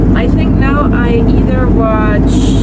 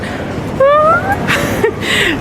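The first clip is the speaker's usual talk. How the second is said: high squeal laugh; 4 dB quieter than usual. Real, not acted. Laughing at being refused entry.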